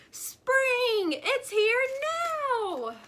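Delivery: high, loud, slow joyful shout. A narrator's reading. Groundhog shouting in joy at the flower. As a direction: shout it out with wonder. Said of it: "Then he shouted".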